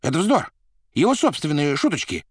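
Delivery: high, loud dismissal; expansive interruption. A narrator's reading. Administrator interrupting the director's doubts, effusively dismissive. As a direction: cut in excitedly and brush it off. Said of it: "перебил экспансивный администратор".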